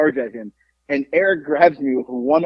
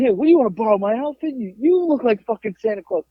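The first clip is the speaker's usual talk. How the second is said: raised-pitch, retold heckle; taunting comeback; 6 semitones higher than usual. Drunk man answers the biker's Santa jibe, acted taunting comeback. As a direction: turn the insult back on him.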